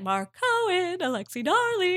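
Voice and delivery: high, bright newscaster sign-off; Alexi Darling news voice. Retold or imitated. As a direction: sign off like a TV reporter. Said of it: "Always like".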